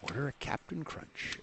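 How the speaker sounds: soft, slow, confidential aside; hushed secret tip. Pretend. Host leaking a secret Starbucks order, hushed. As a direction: slip it out quietly, drawn out.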